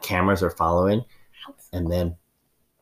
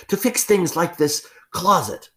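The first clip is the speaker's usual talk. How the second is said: loud pointed cover; 11 semitones higher, 3 dB louder, slower than usual. Acted.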